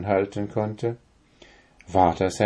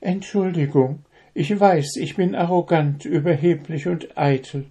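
remorseful; 7 semitones higher than usual. A narrator's reading. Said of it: "trippelte sie zerknirscht".

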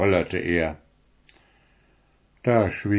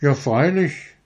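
put-on eager boy; 6 semitones higher than usual. A narrator's reading. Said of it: "sagte Friedrich".

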